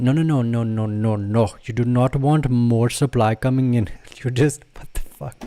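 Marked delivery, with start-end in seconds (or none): 0.0-3.9 put-on a light Indian accent on the no's. Host correcting viewers, lightly in an Indian accent. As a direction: slip into a mild Indian accent. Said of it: "From Southern to my Indian accent".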